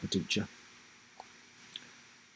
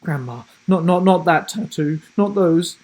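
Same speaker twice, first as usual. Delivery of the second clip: urgent pleading, a bit louder; pleading boy; 7 semitones higher, 7 dB louder than usual. Acted. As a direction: beg her to stop. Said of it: "he pleaded quietly".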